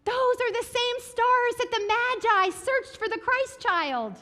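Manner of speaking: high, lightly voiced parent; parents pointing. Parents showing their child the stars, high lightly voiced. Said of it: "And my parents would say".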